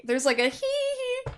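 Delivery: high, mock girlish giggle; imitated girl giggle. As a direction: imitate a girl's little tittering laugh. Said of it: "a girl laughing".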